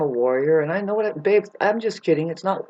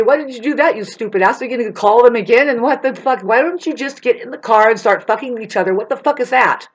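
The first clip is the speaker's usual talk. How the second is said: higher, louder mock scolding; acted yell at a neighbor; imitating a yell; 5 semitones higher, 4 dB louder than usual. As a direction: chew them out like a furious neighbor. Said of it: "yell at you and say".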